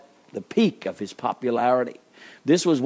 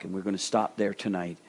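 usual speaking voice

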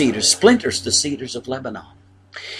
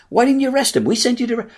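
much higher, sharp indignant demand; indignant Pharisees; 11 semitones higher, much faster than usual. Retold or imitated. Preacher voices Pharisees scolding soldiers, indignant and high. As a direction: play the outraged officials scolding the soldiers. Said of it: "They were".

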